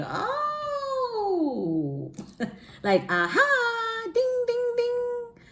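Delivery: very high sing-song aha ding ding ding; lightbulb moment. Retold or imitated. Mocking her own slow realization, high and bright. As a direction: ring the idea bell playfully. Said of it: "'cause I'm like".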